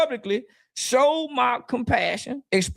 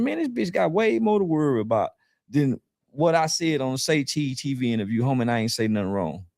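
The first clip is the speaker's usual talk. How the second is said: retold retort; 8 semitones lower than usual. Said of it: "I'm saying".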